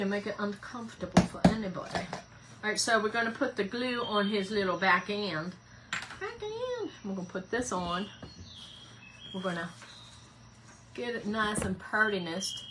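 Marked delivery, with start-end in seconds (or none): 5.9-6.9 squeaky high voice. Pretend. Repeat of "back end", squeaky.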